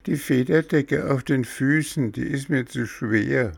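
put-on high-pitched, protesting child figure; high, fussy boy stalling over medicine; whiny puppet boy. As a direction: voice a stubborn little patient, high and insistent. Voices fussing Bengele.

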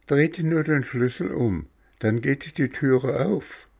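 a tiny creature giving friendly directions; cricket voice. Acted. Cricket tells visitors how to open door, high little voice.

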